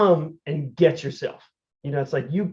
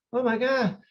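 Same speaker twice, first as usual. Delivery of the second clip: dismayed gasp; 8 semitones higher than usual. Retold or imitated. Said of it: "you know, like".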